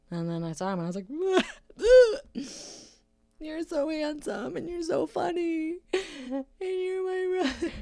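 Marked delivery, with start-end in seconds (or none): 3.4-7.7 high, choked-up voice breaking into laughing tears; laugh-crying, slow and high; teary gushing. Retold or imitated. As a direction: gush through happy tears, voice cracking high. Gushing over brother, in tears.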